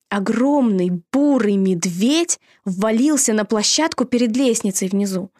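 ordinary delivery, nothing marked

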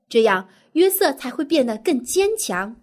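usual speaking voice

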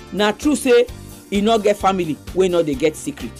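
ordinary delivery

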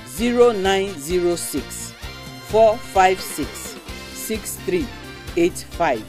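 digits read slowly, one by one; slow number dictation. Shown as a demonstration. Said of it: "Make I take am again".

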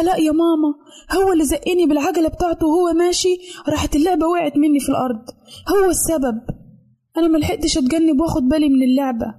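put-on high childish protest; little girl pleading. A narrator's reading. Daughter blaming her brother to mother, high childish protest. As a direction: plead your case like an upset little girl. Mona defends herself to mother.

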